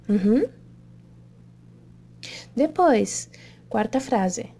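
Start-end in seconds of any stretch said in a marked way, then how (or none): none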